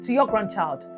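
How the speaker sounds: normal talking voice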